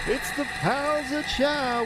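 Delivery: very high, slow, stretched call; drawn-out announcer. Acted. Cohost repeating the show name in a slow high call. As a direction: stretch it like a carnival barker. Repeat opener, slower and higher.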